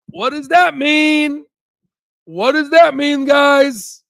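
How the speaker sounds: high and slow, teasing the crowd; hyped question. Real, not acted. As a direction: pump up the viewers. Hyping the audience about gains.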